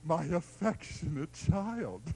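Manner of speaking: slow, soft and tender; affectionate father. Acted. Father answering his raging son, slow and soft. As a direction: coo it like a doting dad. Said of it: "He says".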